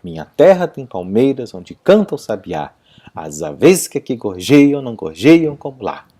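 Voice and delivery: rhythmic "Minha terra tem palmeiras", beats on every third and seventh syllable; poem's cadence. Shown as a demonstration. Teacher showing the poem's cadence, rhythmic stresses with "aves" said a-VES. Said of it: "garante a cadência do poema".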